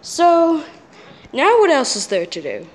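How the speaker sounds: ordinary delivery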